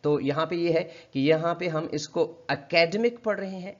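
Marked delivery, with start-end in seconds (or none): 2.5-3.2 wrong three-beat uh-KAD-mik for एकेडमिक; mispronounced एकेडमिक. Shown as a demonstration. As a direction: stress on the second syllable of एकेडमिक. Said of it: "stress गलत जगह पे दे रहे हैं".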